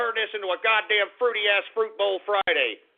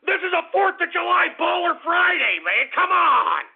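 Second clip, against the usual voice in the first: high, loud exasperated shout; fed-up outburst, higher and louder; 8 semitones higher, 4 dB louder than usual. Real, not acted. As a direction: yell it out at the whole audience. Shouting at callers on air.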